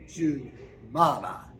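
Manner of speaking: ordinary delivery, nothing marked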